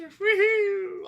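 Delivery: high, loud sarcastic woohoo; hammed-up high, loud celebration; mock cheer. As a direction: cheer like you won, though you lost. Mock cheering lost money.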